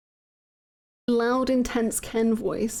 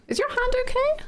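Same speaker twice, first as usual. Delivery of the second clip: much higher, cartoonish concern; high squeaky voice; 12 semitones higher than usual. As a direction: squeak the question. High character voice.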